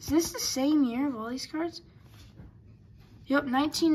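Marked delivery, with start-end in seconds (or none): none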